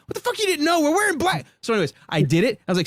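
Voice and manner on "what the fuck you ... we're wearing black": high, incredulous mock outrage; exasperated disbelief. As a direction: throw your hands up at this idiot. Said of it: "I'm like".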